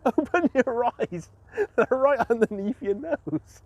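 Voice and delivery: high, drawn-out mock scolding with laughter; exasperated wife. Blind man re-enacting his wife's tea-bag retort, laughing exasperation. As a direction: slowly hammer it home as the fed-up wife. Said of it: "That's what she says".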